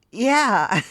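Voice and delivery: a loud, high burst of delight; joyful shout. Acted. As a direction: shout it out with sudden joy. Pet delighted master understands.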